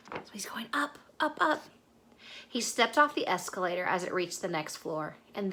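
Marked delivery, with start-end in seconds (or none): 0.1-1.6 whispered aside. Shown as a demonstration. Storyteller whispering to the child as the bear rides up. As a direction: whisper it like a secret. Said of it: "whispered aside".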